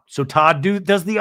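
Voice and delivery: normal talking voice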